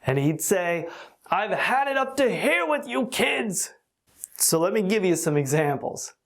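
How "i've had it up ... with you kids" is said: higher-pitched mock exasperated father; imitating angry father. Father scolding his noisy kids, mock exasperated. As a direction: play the fed-up dad at his noisy kids. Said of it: "our father was furious with us".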